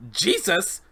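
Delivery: "Jesus" exclaimed high, falling at the end; shocked "Jesus". Acted. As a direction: blurt "Jesus" in disbelief.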